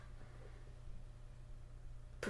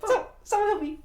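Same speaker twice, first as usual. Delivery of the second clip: fleeing shout; 13 semitones higher than usual. Acted. Brother chased around the room, mock cry for help. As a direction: yell while running away.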